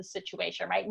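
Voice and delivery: ordinary talk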